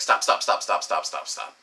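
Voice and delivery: rapid-fire string of stops, falling away; retold urgent stopping. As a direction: rattle off the repeated word, imitating urgency. Retold urgent plea to stop.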